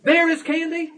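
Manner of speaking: high-pitched full sentence, mock childlike; put-on high child's statement; mock wordy child. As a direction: say it high, as an unlikely kid's remark. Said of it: "waste their time by saying".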